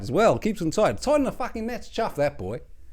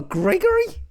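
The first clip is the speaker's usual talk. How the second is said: incredulous; slower than usual. Acted. Incredulous at the posh name.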